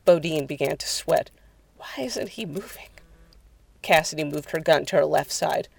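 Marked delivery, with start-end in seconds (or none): 1.8-2.9 soft, high whisper; uneasy whisper to companions; whispered question. Acted. Bodine sweating as Jared stands still, whispering. Frightened by the motionless man.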